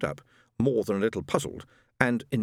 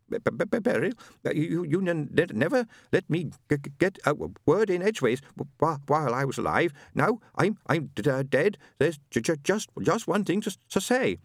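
stammering husband; 7 semitones higher than usual. Acted. Ron stammers throughout.

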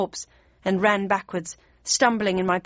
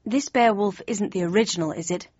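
ordinary delivery, nothing marked

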